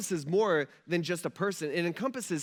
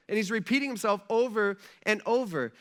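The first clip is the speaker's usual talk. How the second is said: ordinary talk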